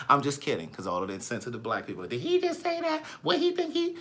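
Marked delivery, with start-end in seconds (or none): 2.1-4.0 very high-pitched mock-offended listeners; mocking viewers' voices. Imitating insensitive viewers reacting to his joke, mocking high voice. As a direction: squeak it like a scandalized audience. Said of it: "that's how I imagine y'all voices to be".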